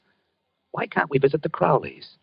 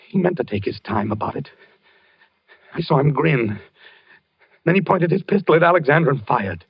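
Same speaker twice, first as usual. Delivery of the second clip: ordinary delivery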